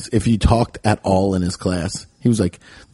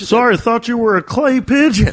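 much higher, joking apology; mock dad; 11 semitones higher than usual. Friend voicing the dad who fires over cars, higher. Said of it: "mock dad line".

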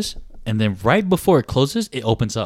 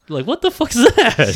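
high, loud incredulous outburst; retold disbelief; 12 semitones higher, 6 dB louder than usual. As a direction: exclaim it in disbelief. Retold reaction to kids jumping.

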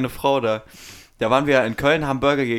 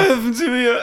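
high mock holler through laughter; imitated yelling; 11 semitones higher, 3 dB louder than usual. Co-host echoes homeless man's street yelling, high mock holler. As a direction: mimic the drunk's cry for help. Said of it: "dieses Anbrüllen auf offener Straße".

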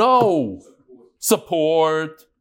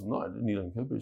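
imitating a husband, low and much softer; husband's mild denial; 8 semitones lower, 12 dB quieter, faster than usual. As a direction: drop to a low, quiet denial. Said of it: "He goes".

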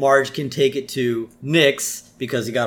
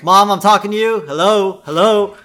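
loud, high, insistent whine for attention; pestering kid, pitched up and loud; Bart; 7 semitones higher, 8 dB louder than usual. Retold or imitated. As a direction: demand Mom listens, raised voice. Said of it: "And Bart's".

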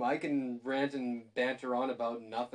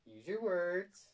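teasing; 4 semitones higher, 3 dB quieter, much slower than usual. Acted. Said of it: "I'm trying".

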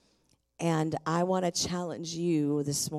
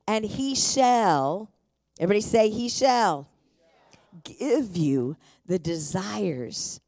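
raised, drawn-out proclamation of scripture; emphatic preaching; 3 semitones higher, 5 dB louder than usual. Real, not acted. Pastor announces delight as her theme verse, loud emphatic preaching. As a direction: proclaim it to the whole room, stretching key words.

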